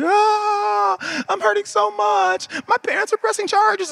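put-on high-pitched, slowed whiny kid wail; mock wailing child. Hurt little girl to her teacher, hammed-up high wail. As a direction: ham up a little girl crying over a fall. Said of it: "she starts wailing".

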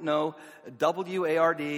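exasperated sing-song spelling of W A R D; spelled out. Retold or imitated. Spelling his name out for receptionist.

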